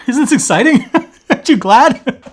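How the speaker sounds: high, loud, giggling thrill; laughing excitement. Real, not acted. Host back after four months, giggling excitedly. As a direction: bubble over with laughter. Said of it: "I'm just excited".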